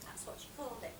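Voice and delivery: soft, nearly whispered aside; hushed aside. Acted. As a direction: drop your voice for a confidential aside. Aside to the audience.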